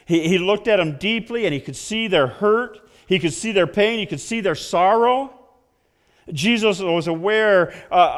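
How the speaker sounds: loud, high preacher's build; preaching. Acted. Pastor preaching to his congregation, loud and high. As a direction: preach it out to the pews.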